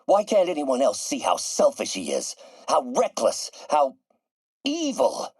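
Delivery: exasperated rant climbing in pitch to the last word; aggrieved, high-pitched venting about a rival; bitter outrage. Acted. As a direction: build indignation, then pause before the high final word. Venting alone about a hated rival.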